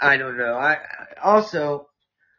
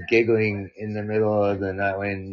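ordinary talk

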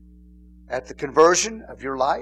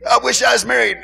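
mock longing cry; 6 semitones higher, 7 dB louder, faster than usual. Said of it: "quoting singles".

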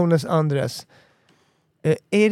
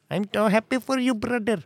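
put-on Indian accent; 5 semitones higher than usual. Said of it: "med indisk brytning".

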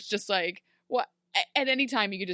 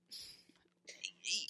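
whisper; 5 semitones lower, 16 dB quieter than usual. Retold or imitated. Emma tells a lie.

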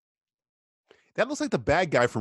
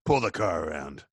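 villain boss voice; 8 semitones lower, 3 dB quieter than usual. Pretend. Voicing the legless villain.

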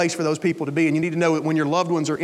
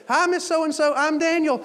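higher-pitched hello to a patient; pitched-up friendly greeting; acted cheery greeting; 8 semitones higher than usual. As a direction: introduce yourself brightly, re-enacted.

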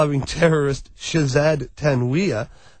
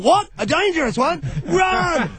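mock alarm; 11 semitones higher than usual. Mock alarm at article wording.

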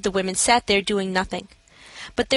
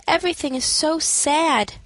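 haphazard sad voice; 7 semitones higher than usual. A narrator's reading. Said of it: "I said in a haphazard way".

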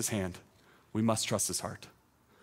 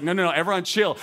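taking charge; 6 semitones higher, 5 dB louder, faster than usual. Pretend. Acting out calming a panicking crowd.

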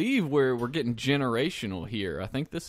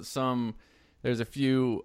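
normal talking voice

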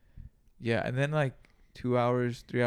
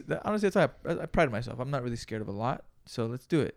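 usual speaking voice

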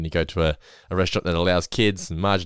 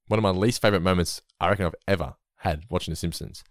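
normal talking voice

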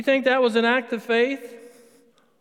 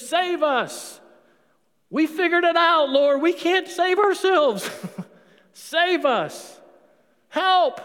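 high, loud pleading cry; desperate cry for help; 9 semitones higher, 5 dB louder, slower than usual. Acted. People crying to the Lord to save them, pleading loudly.

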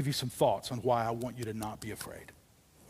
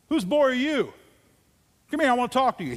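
put-on high, loud king calling the boy over; a commanding king, hammed up; a hint of an imperious Saul; Saul demanding; 10 semitones higher, 9 dB louder, faster than usual. King Saul voiced in story.

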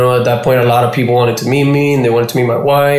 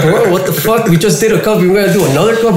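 incredulous protest; 7 semitones higher, faster than usual. Retold or imitated. Friend pushes another competition.

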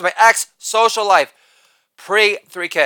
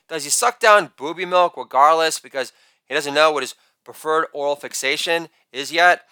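ordinary talk, nothing marked

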